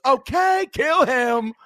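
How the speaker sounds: very high sudden okay; Jim Carrey impression. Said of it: "Jim Carrey goes".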